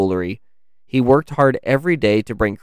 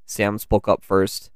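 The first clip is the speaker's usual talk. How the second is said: plain reading voice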